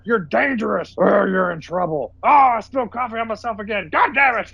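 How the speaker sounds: high, strained ranting boss; hammed-up tirade from a furious officer; mock angry coach. Officer scolding the pilot about the spilled coffee, in a high hammed-up rant. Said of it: "he goes".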